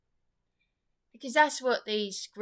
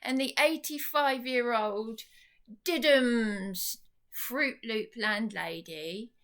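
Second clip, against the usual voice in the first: ordinary talk, nothing marked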